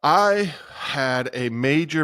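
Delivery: ordinary talk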